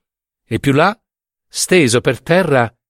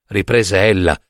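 plain reading voice

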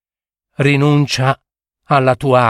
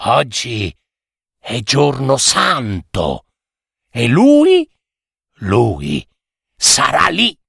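hint at him like a witch; secretive witch. Acted. Witch warning the fisherman about him, secretive.

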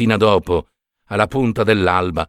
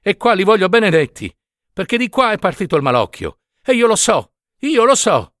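much higher, urgent and insistent; fervent insistence; 9 semitones higher than usual. A narrator's reading. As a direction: she will not be refused. Woman insists on the blessing.

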